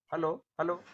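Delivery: raising the voice for the mic; projecting to be heard. Real, not acted. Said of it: "Please speak little louder".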